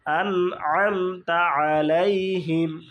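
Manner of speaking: "আন আম তা আলাইহিম" slowly, each syllable held; slow tajweed demonstration. Shown as a demonstration. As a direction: squeeze the throat for the ain in "আম" and "আলাইহিম". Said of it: "আইনে উচ্চারণ আম". ("আন আম তা আলাইহিম" ধীরে, আইন কণ্ঠ চেপে)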